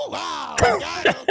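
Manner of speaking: high, loud crowing; whooping, high-pitched celebration; triumphant shout. Real, not acted. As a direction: shout it like you just landed the winning burn. Crowing after landing a joke insult.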